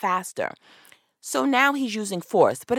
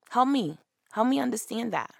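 ordinary delivery

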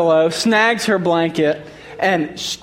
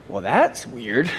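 low slow deadpan; 7 semitones lower, 5 dB quieter, slower than usual. Retold or imitated. Hearing dog scream, half asleep.

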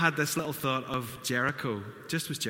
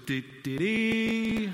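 sung trumpet fanfare, last note high and loud; trumpet blast; 10 semitones higher, 4 dB louder, much slower than usual. Retold or imitated. Pastor imitating the trumpet at Jericho's walls, mock fanfare. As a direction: toot it out like a trumpet call. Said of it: "Puts the trumpet to his mouth and goes".

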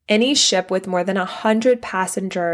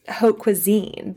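ordinary talk

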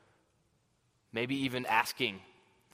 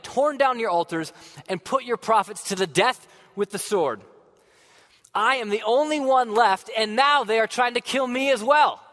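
much higher and louder, rising furious complaint; angry, harsh; 8 semitones higher, 8 dB louder than usual. Acted. Elijah answering Yahweh in the cave, angry and harsh, much louder. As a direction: hurl the grievance at God, building in anger. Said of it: "in an angry, upset, harsh voice".

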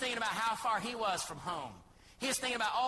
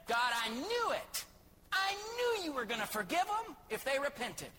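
higher-pitched, complaining prophet's voice; exasperated Jonah; 7 semitones higher than usual. Acted. Jonah grumbling to God about forgiving Nineveh, higher and exasperated. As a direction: whine at God like a sulking prophet. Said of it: "Jonah said".

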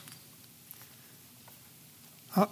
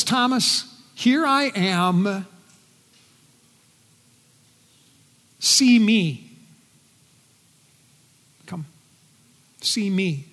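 gentle reveal; 4 semitones lower, 4 dB quieter than usual. Acted. Jesus reveals himself to Thomas.

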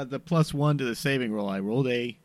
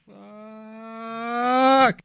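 exasperated curse; 8 semitones higher than usual. Acted. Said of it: "this is what they hear".

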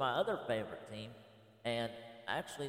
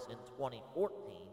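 usual speaking voice

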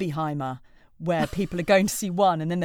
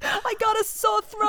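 mock patient; 14 semitones higher, slower than usual. Joke patient pestering the doctor.

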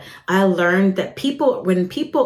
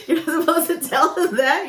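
laughing; 9 semitones higher than usual. Real, not acted. Laughing at her own rudeness.

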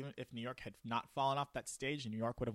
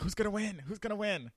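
hype announcer; 9 semitones higher, faster than usual. Pretend. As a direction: hype it like the Super Bowl. Hyped sports echo.

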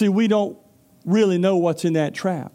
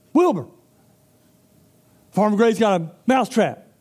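higher and louder, urgent plea; mouse calling the pig; 5 semitones higher, 4 dB louder than usual. Acted. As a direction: call out to Wilbur in alarm.

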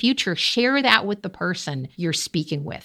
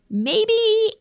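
playful high maybe; 13 semitones higher than usual. Real, not acted. Coach answering her own question with a playful high maybe. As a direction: pitch jumps way up on maybe.

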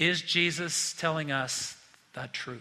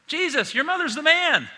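much higher, louder mock admirer; higher, louder gushing bystander; mock crowd woman; 10 semitones higher, 6 dB louder than usual. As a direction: gush up at Jesus about his mother.

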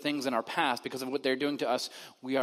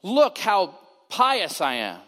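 slow, showy self-righteous boast, louder and higher; mock pious boast; 5 semitones higher, 7 dB louder, much slower than usual. Preacher mocking the Pharisees who flaunt devotion, hammed-up smug. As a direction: parade your piety in a slow, smug drawl. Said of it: "Pharisees who run around making sure everyone".

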